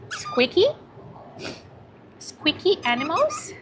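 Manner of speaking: high, sing-song talk to a dog. Real, not acted. Coaxing the shy dog Maria on stream, cooing baby talk. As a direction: coo at the puppy to play.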